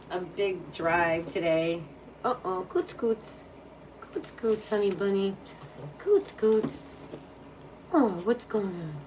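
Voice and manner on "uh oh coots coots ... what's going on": higher, sing-song pet voice. Real, not acted. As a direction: coo his nickname over and over. Cooing at the pet.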